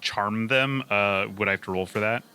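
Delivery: ordinary delivery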